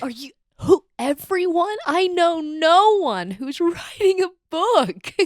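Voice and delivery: high, loud, slow words broken by laughter; laughing disbelief. Real, not acted. Friend laughing in disbelief about everyone writing books. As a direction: laugh it out, can't believe it.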